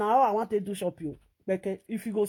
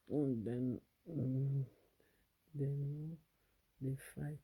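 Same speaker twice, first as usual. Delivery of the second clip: much lower and softer, slow; hushed, much lower and softer; 7 semitones lower, 13 dB quieter, slower than usual. Real, not acted. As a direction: murmur it low and slow like a tired aside. Lower, much quieter held voice.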